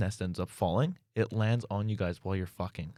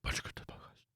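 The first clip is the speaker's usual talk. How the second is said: Batman voice; 7 dB quieter, faster than usual. Acted. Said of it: "my Batman voice".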